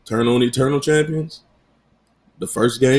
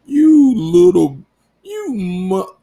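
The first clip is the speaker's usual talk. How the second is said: loud, slow, higher, cut-off threat; Denzel Training Day menace; 9 semitones higher, 5 dB louder, much slower than usual. Retold or imitated. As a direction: channel Denzel about to cuss someone out. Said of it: "I felt like Denzel off Training Day".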